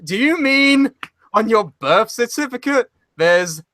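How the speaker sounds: high-pitched mock of the hosts; mock incredulous host. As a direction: ham up the baffled interviewer. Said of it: "they're like".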